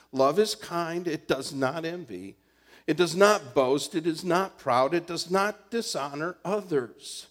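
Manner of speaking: loud, driving preaching; fervent preaching. Acted. Pastor preaching the love passage to the church, loud. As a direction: hammer each clause home to the congregation.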